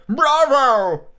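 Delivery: loud, high cheer; crying out. A narrator's reading. Squire applauding the story, a high loud cry. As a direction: bellow it as the delighted squire. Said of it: "and the squire cried".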